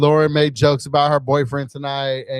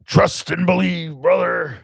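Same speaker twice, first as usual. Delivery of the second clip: slow, higher wrestler growl-out; Hulk Hogan; 4 semitones higher, slower than usual. Acted. Host showing co-host the wrestler line, slow wrestler impression. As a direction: stretch it like a wrestler's promo. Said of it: "Okay, do Hulk Hogan".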